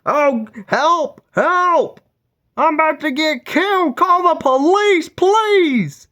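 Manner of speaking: very high, shrieking cry for help; screaming lady. Retold or imitated. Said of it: "this lady's like".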